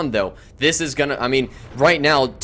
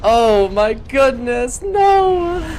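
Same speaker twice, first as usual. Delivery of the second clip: much higher and louder cries; laughing screams of disbelief; 14 semitones higher, 4 dB louder, much slower than usual. Real, not acted. Caster to viewers at the final upset, laughing screams.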